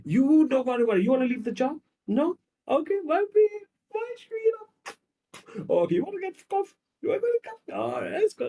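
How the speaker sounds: put-on very high, cooing boyfriend voice; hammed-up babying, way up in pitch; doting comforter. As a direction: fuss over your sweetheart in exaggerated sympathy. Acted doting man comforting his woman.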